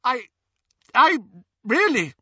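halting, stammered start; stammering. A narrator's reading. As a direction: stumble over the first words. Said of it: "stammered Hermione".

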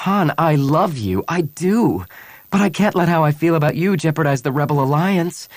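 high, loud and urgent; anguished, emphatic declaration; impassioned plea. A narrator's reading. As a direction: plead your case with rising voice. Anguished woman pleads her cause.

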